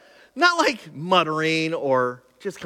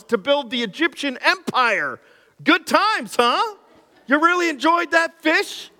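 sarcastic retort; 7 semitones higher than usual. Acted. Preacher ribbing the grumbling Israelites, high and incredulous. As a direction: needle them with disbelief.

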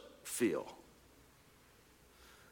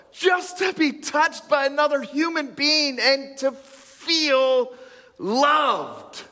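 fervent preaching; 7 semitones higher than usual. Real, not acted. Preacher builds to the point.

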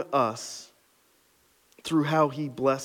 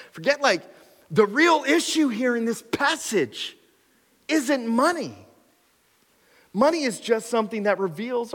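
much higher and louder, near shouting; preaching at full force; 11 semitones higher, 3 dB louder than usual. Acted. Pastor pressing the church on their hearts, impassioned and loud. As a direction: drive the point home with fire.

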